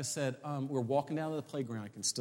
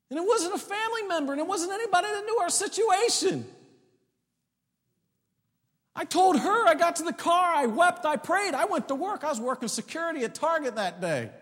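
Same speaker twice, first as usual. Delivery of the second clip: high, loud shouted testimony; full-force preaching; 12 dB louder than usual. Acted. Preacher telling his church the rent was paid, shouting high. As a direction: shout the miracle to the whole room, pitched way up.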